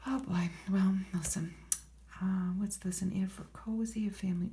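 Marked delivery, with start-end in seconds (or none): none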